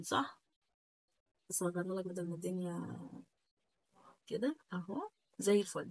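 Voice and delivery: ordinary talk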